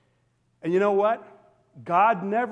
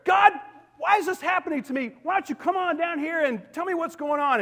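much higher, quicker pleading at God; pleading cry to God; 10 semitones higher than usual. Retold or imitated. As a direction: cry out to heaven in bewilderment. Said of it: "Can you imagine if you sit there".

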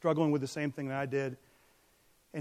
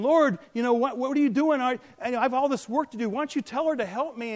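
much higher and louder, exasperated sister; complaining Martha; 9 semitones higher, 5 dB louder than usual. Acted. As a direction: whine at the Lord about all the work. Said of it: "And Martha comes in".

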